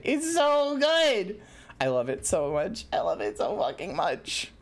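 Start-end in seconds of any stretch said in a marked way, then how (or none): 0.0-1.4 high, long-drawn squeal of delight; overjoyed squeal. Real, not acted. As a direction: stretch it out, bursting with joy. Repeats praise, building in delight.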